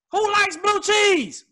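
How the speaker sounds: high, slow disbelief; incredulous. Real, not acted. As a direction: ask it like it's crazy. Incredulous repeat.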